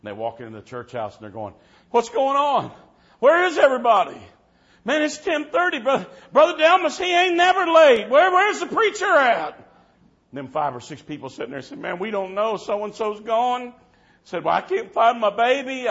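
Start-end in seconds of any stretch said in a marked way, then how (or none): 1.9-9.6 put-on high, loud, frantic kids calling out; panicked kids. Kids in the skit shouting for the missing preacher, frantic. 11.8-13.7 high, louder, slow worried reply; worried churchgoers. Retold or imitated. Congregation voiced in skit. 14.4-15.9 high, loud, frightened; frightened parent. Retold or imitated. Scared parent voiced.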